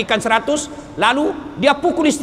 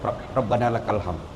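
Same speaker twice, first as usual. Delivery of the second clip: mumbled prayer; 13 semitones lower, 9 dB quieter than usual. Retold or imitated. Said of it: "Mana ini seratus".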